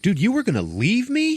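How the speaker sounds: high-pitched indignant question; mock outrage. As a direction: act out feeling betrayed and incredulous. [name] outraged at abandonment.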